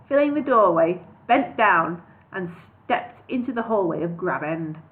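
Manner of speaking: usual speaking voice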